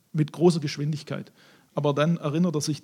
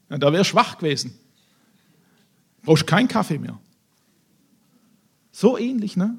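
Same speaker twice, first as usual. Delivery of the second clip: usual speaking voice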